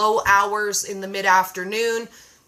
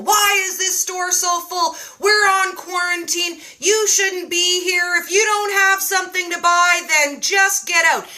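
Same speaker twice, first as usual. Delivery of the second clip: higher, slowed mock megaphone rant; hammed-up angry shopper yelling at the crowd; imitating a yelling man; 6 semitones higher than usual. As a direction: bark it like a guy scolding shoppers through a megaphone. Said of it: "starts yelling at people".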